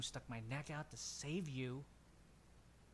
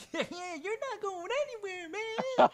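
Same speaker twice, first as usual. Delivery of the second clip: much higher, louder hollering; rowdy drunk; 11 dB louder than usual. Acted. Mac shouts at Skag for one more round, much higher and louder. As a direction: roar for another round. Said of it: "He goes".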